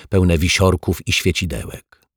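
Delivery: ordinary delivery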